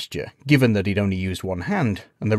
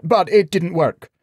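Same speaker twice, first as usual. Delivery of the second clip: higher, louder blurt; rushing to explain; 9 semitones higher, 5 dB louder than usual. A narrator's reading. Knight hastily fills an awkward silence, flustered. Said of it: "Gawain rushed to fill it".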